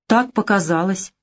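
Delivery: ordinary delivery, nothing marked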